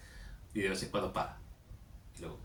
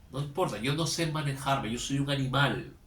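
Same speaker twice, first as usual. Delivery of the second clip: raised, louder voice of helpless self-reproach; mock confession; 6 semitones higher, 7 dB louder than usual. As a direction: imitate someone confessing they can't govern themselves. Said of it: "Básicamente es, es una confesión".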